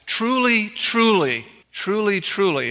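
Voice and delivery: first truly lifted high, then weighing each one; solemn emphasis. Acted. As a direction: intone it like a solemn oath. Solemn Jesus formula, repeated.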